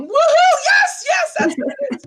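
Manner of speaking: high, loud hooting cheer; mock cheering. Men in the audience cheering at the point, hooting mock cheer. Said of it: "men out there are probably going".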